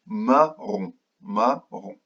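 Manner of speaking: "Marron" split into two beats, mar, ron; syllable by syllable. Shown as a demonstration. Teacher counting syllables with children, "Marron" split in two. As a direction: break "Marron" clearly between its two syllables. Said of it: "combien de syllabes a ce mot".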